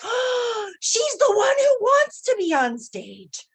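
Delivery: gasp, then very high, loud and drawn out; astonished realization. Acted. Woman realizes her inner child wants the stage, amazed high cry. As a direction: cry it out as a sudden revelation.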